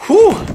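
loud high sigh of relief; relieved whew. Acted. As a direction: blow out a big whew. Relief after finishing homework.

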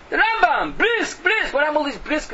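high, loud exhortation; indignant outcry. Real, not acted. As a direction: cry it out in protest. Exclaims in indignation at listeners.

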